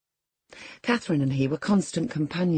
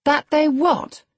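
high, loud, drawn-out demand; sharp cry; 11 semitones higher, 7 dB louder, slower than usual. A narrator's reading. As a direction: cry it out slowly, far up in pitch. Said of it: "cried Catherine".

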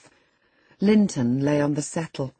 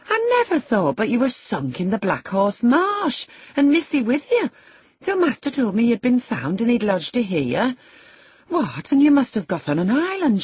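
lively country servant, swooping high; chatty servant Zillah; 8 semitones higher, 4 dB louder than usual. A narrator's reading. Zillah greets the housekeeper found after the marsh, chatty and amazed. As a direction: gossip with high, rising surprise.

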